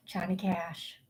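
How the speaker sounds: soft sighed whisper; hushed. Real, not acted. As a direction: say it on a sigh, barely voiced. Names a song artist after pause.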